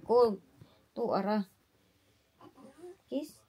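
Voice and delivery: ordinary talk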